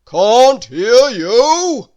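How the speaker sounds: drawn-out grocer's bellow; thundering. A narrator's reading. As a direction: thunder it out word by word. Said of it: "thundered the grocer".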